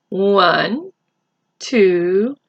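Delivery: ordinary delivery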